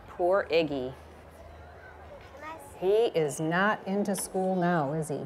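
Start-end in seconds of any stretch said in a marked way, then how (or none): none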